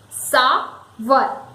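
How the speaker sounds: "sour" broken as SAA... wer; syllable by syllable. Shown as a demonstration. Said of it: "it is sour".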